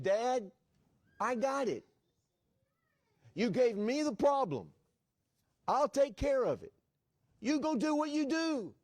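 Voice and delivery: high, ringing proclamation with pauses; emphatic preaching. Acted. Preacher echoing his son's promise as God's, emphatic proclamation. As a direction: drive each short phrase home, high and loud.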